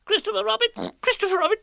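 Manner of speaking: high squeaky calls; crying out. A narrator's reading. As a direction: call out desperately. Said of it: "cried Piglet".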